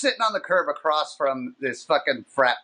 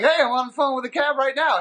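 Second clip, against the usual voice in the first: high-pitched retold urgent call; mock urgent holler; urgent phone call; 9 semitones higher, 3 dB louder than usual. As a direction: pipe up insistently into a phone.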